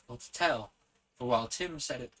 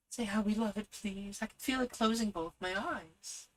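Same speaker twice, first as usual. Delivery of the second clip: put-on excited child; 10 semitones higher than usual.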